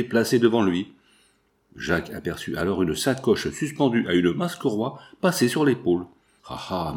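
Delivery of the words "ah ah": soft low murmur, to himself. Acted. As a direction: murmure pour soi-même. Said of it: "murmura-t-il". (murmure à voix basse)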